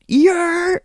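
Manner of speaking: sudden high yelling call; heckler yell. Retold or imitated. Heckler calling out to the comedian, loud high yell. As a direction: bark it out like a rowdy heckler.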